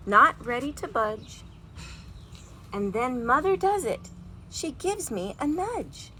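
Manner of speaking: lively storybook read-aloud with big feelings; reading to children. A narrator's reading. Teacher reading a picture book aloud to her class, animated. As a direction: read it like a bedtime story for kids.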